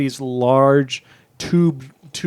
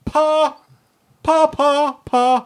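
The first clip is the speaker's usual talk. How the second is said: high, loud cartoon pa pa pa; hammed-up high repeated pa; high, louder call of pa; mock character call; 12 semitones higher, 3 dB louder, much slower than usual. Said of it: "Do you think she says".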